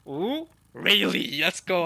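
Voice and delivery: loud, drawn-out cheer; excited cheer. Real, not acted. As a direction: hype it up for the hero. Cheering for Rayleigh.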